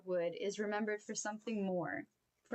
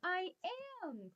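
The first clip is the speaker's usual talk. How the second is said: a high, eager burst of a reply; enthusiastic cry; 10 semitones higher than usual. Acted. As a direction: jump in, thrilled to be asked. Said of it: "cried Toad enthusiastically".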